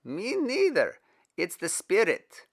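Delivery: a laugh then a high, loud answer; laughing delight. Acted. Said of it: "Stephen laughed".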